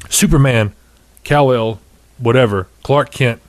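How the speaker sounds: ordinary talk, nothing marked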